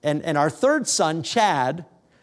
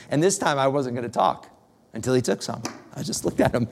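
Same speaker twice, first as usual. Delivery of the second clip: usual speaking voice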